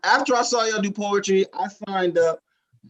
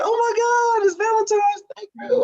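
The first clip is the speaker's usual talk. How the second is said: far higher, thrilled squeal; imitating excited girlfriend; 13 semitones higher than usual. Girlfriend thanking him for a gift, squealed high. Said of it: "she was like".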